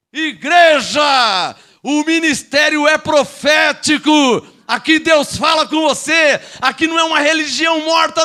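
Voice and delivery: slow, loud shouted sermon; stretched, booming preacher cry; full-force preaching. Acted. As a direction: drive each word to the back of the church.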